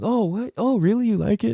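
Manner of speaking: high-pitched mock modesty; fake-humble, lilting questions; feigned surprise. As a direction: act clueless at the compliment. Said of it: "I'm just gonna act like".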